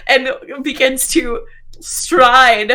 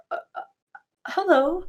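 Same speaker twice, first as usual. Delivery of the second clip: soft, hesitant stammer, hello pitched up; halting, unsure hello after false starts; 7 semitones higher, 6 dB quieter than usual. Acted. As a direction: stumble timidly into a greeting. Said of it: "then kind of stammers as".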